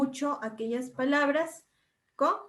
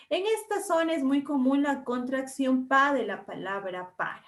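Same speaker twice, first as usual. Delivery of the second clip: ordinary delivery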